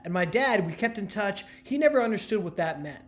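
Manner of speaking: ordinary talk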